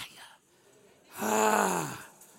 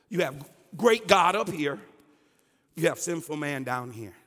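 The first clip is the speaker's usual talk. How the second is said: usual speaking voice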